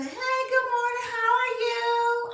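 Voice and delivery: high, singsong friendly greeting; cheery driver greeting. Retold or imitated. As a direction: sing it out brightly like a chipper driver.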